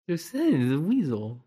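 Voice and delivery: weasel voice; sly hissing weasel. Acted. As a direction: squeak it out like a sneaky weasel. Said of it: "your outstanding weasel impression".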